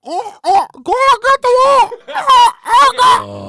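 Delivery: high, loud fake moaning; hammy loud moaning. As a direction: overact loud moans. Mock loud moaning behind clown.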